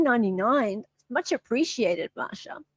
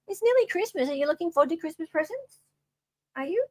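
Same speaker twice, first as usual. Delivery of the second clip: pet talk; 5 semitones higher, 4 dB quieter, faster than usual. Real, not acted. Talking to cat.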